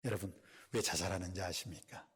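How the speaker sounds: ordinary talk, nothing marked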